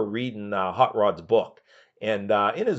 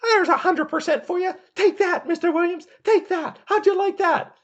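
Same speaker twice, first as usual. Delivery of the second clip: much higher, louder gloating taunt; Roddy Piper impression; 5 dB louder than usual. Piper gloating after throwing the guest off the set, high loud taunt. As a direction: gloat over him after the attack. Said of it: "and goes".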